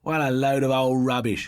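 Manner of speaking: put-on cheerful boy scoffing at the ghost story. A narrator's reading. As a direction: brush it off with a grin. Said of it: "came a cheerful voice".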